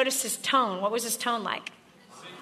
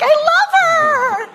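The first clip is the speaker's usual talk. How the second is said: gushing sapphire; 12 dB louder, slower than usual. Retold or imitated. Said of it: "they're like".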